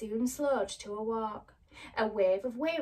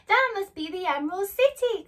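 higher, louder with delight; excited cry; 10 semitones higher, 9 dB louder than usual. Acted. Dorothy excitedly spots the Emerald City. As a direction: exclaim with joy. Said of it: "cried Dorothy".

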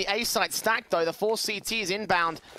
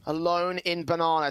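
ordinary talk

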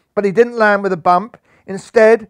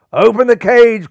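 loud, higher commanding cry; cried out; 5 semitones higher, 8 dB louder than usual. A narrator's reading. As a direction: call it out across the arena. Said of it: "cried Catman".

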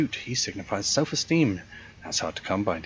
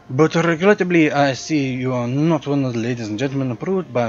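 louder, hammed-up French-European accent for a snooty concierge; hotel concierge greeting a guest, in a louder generic European accent; 4 semitones higher, 6 dB louder than usual. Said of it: "my generic European accent".

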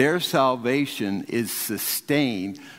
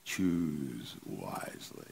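low, slow, hushed wise-man voice; wise old man; 7 semitones lower, 12 dB quieter, much slower than usual. Retold or imitated. Preacher quoting the Indiana Jones wise man, softly and slowly. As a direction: intone it softly and slowly like an ancient sage. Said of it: "the wise man says".

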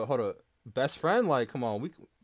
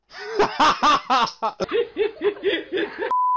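loud laugh; 7 dB louder than usual. Real, not acted. Big laugh at drunk guy.

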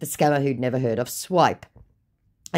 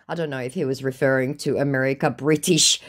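ordinary talk, nothing marked

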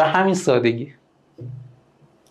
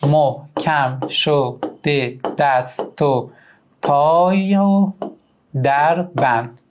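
very slow syllable-by-syllable reading; slow scansion; much slower than usual. Shown as a demonstration. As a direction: drag out each syllable, lift on پای و. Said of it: "بذارید تختی اینجا انجام بدم".

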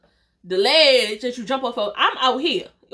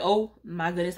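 fed-up grumble; 6 semitones lower, 7 dB quieter than usual. Retold or imitated. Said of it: "y'all know I had an attitude".

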